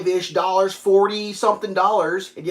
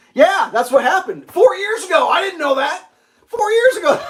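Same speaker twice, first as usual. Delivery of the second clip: high, loud surprised repetition; shocked at the news; can't believe it was so long ago; 11 semitones higher, 5 dB louder than usual. Real, not acted. Surprised reaction to listener news.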